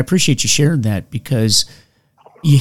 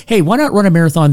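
quicker, higher and brighter, egging himself on; mock eager; 6 semitones higher, 3 dB louder, faster than usual. Telling a fellow addict how he escalated, mock eager. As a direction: hype yourself into the next challenge. Mocking his own escalating logic.

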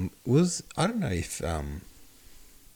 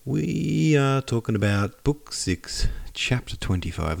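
normal talking voice

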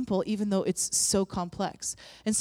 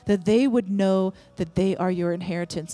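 loud, declaring prayer against every lie; fervent prayer; 4 dB louder than usual. Acted. Pastor declares over the congregation, loud and fervent. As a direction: come against the lies with full voice. Prays against lies, music.

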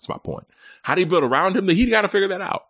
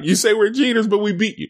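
Carlos Correa; 8 semitones higher than usual. Retold or imitated. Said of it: "Carlos Correa is like".

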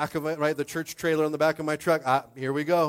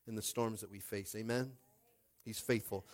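ordinary talk, nothing marked

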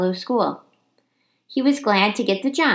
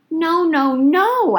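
high, louder panicked squeaks; frightened squeak; 9 semitones higher, 4 dB louder, much slower than usual. Acted. Hamster begging janitor not to turn off lights, panicked. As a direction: cry out before the dark comes. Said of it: "I squeaked".

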